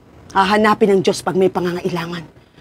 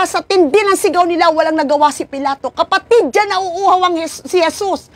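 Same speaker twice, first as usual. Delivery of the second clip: high, fervent preaching voice; 8 semitones higher than usual. Acted. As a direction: press the point on the congregation. (mataas at masidhing pangangaral)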